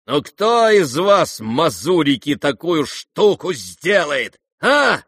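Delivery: loud, slow boast ending in a high shout; taunting challenge. Acted. Rogozhin taunting the guests, loud and boastful. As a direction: dare the room, then yell a.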